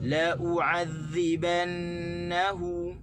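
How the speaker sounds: very slow chant stressing "na" and "hu"; "La u'adzibannahu" chanted slowly with the stress on "na" and "hu"; correct Quran recitation. Shown as a demonstration. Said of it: "di na dan di hu tu". (tekanan pada "na" dan "hu", perlahan)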